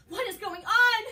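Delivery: high, loud, bewildered cry; frightened patient shouting in confusion; panicked. Acted. Patient seeing things, panicking.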